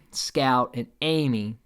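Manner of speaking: ordinary talk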